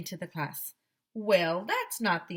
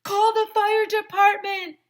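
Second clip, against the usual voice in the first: high, loud urgent yell; loud high panicked call; frantic yell; 11 dB louder than usual. A narrator's reading. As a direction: yell for help across the room. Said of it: "yelled Alexia".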